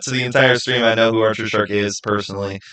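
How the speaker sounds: ordinary talk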